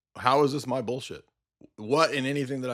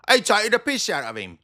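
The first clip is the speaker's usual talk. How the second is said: mock banter; 7 semitones higher, 4 dB louder than usual. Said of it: "mocking friend banter".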